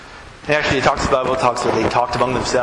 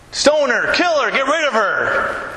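angry mob; 9 semitones higher than usual. Acted. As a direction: spit it out like a furious crowd. Acted angry crowd's demand.

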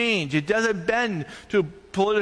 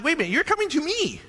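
high, quick incredulous retort; mock exasperation; 10 semitones higher, faster than usual. Pastor answering indignant funeral lady, exasperated. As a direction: cut in, high-pitched and exasperated.

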